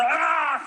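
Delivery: loud, high yell like the Hulk; Hulk roar. Retold or imitated. Comedian showing how Hulk jumps through windows, Hulk yell. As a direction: roar as if crashing through a wall. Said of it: "like the Hulk".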